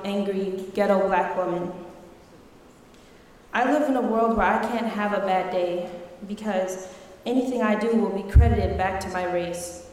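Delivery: normal talking voice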